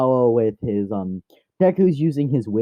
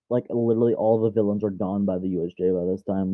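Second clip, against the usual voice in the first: ordinary talk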